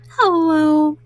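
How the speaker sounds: ordinary delivery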